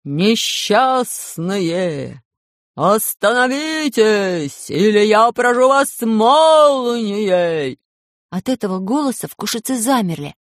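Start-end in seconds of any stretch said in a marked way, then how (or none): 0.0-7.8 very slow, booming voice from above; thunderous voice from the sky. Acted. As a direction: boom it slowly like a heavenly threat. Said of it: "послышался громовой голос".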